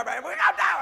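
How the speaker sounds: imitated friend's panicked shout; screaming in panic. Friend in flipping car, acted panicked scream. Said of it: "He was screaming".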